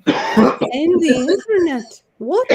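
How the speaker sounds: high, loud, slow stunned question; shocked disbelief. Real, not acted. Reacting to the crazy man who can end the internet, shocked disbelief. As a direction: sound truly floored by it.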